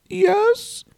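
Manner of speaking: very high squeaky yes. Retold or imitated. Stephanie's retold answer.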